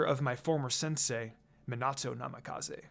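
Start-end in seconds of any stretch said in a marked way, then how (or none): none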